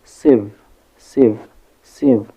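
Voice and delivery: "sieve" repeated alone, loud and clear; isolated syllable demo. Shown as a demonstration. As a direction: drill "sieve" with a short vowel, gaps between. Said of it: "and we have sieve".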